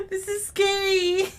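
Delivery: high, slow whimper; tearful, drawn-out scared moan; whimpering fear. Real, not acted. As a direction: whine it out, shaken. Still shaken by the scare.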